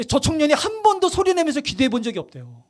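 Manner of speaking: ordinary talk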